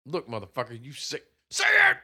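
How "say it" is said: shouting. Acted. Mock demand, name said wrong.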